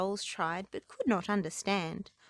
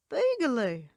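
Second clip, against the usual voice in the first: shy happy troll; 5 semitones higher, 5 dB louder than usual. Acted. Troll says his name.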